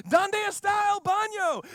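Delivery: high, loud panicked yelling; hammed-up panicked tourist, high and loud; mock frantic tourist. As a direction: yell it like a lost American on a slide. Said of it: "being like".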